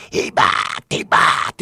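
loud, strained yell from afar; shouted accusation. Retold or imitated. Manager calling a balk from the dugout, imitated shout. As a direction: holler it out to the umpire. Manager calls out during game.

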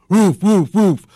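high, loud dog woofs; joke-telling dog bark, high and loud. Acted. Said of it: "And the dog goes".

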